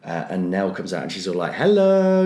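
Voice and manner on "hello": high, louder sing-song hello; chirpy greeting. Retold or imitated. Nell greeting the audience, hammed-up. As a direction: pop out with a bright mock hello.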